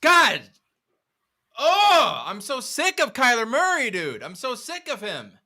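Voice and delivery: exasperated high-pitched outburst; fed-up fan losing it; escalating comedy rant. Real, not acted.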